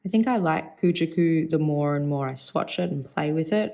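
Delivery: ordinary delivery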